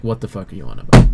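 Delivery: quick, mildly angry disbelief; still angry, baffled. Acted. Game master voices the commander reacting to the player, quick and mildly angry. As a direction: rattle it off with a touch of anger. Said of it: "Like, still angry".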